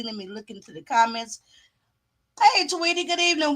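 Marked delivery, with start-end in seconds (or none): none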